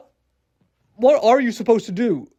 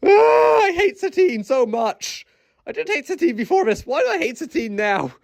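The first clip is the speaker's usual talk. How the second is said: exasperated rant; 7 semitones higher than usual. Real, not acted. Venting frustration at Satine.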